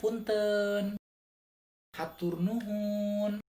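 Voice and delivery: high, drawn-out Sundanese courtesy phrases; Sundanese accent. Shown as a demonstration. Teaching viewers typical Sundanese vocabulary, high drawn-out Sundanese accent. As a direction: stretch the endings in a Sundanese lilt. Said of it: "yang memang orang Sunda banget".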